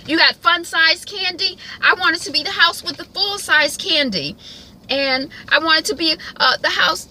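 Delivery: high, loud, pleading Randall; high-pitched Randall impression. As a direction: voice him high and loud, like an eager kid. Voicing Randall's wish list.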